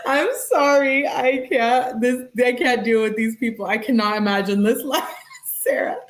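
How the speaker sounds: can't stop laughing while talking; laughing fit. Real, not acted. To viewers, helpless with laughter.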